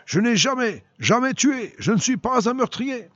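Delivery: high, pleading protest in broken phrases; desperate cry. A narrator's reading. Accused soldier defending himself to his lieutenant, desperate cry. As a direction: cry out in self-defence, pitch right up. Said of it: "Il eut un cri de défense suprême".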